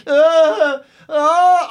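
loud high pained moaning; pained groan. Retold or imitated. Patient alone at night, groaning in pain. Retelling agony in hospital bed.

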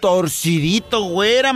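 ordinary delivery

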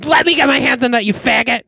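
high-pitched joking attack; mock outburst. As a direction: lunge in with a high, pretend-angry cry. Joking imagined outburst, then "just kidding.

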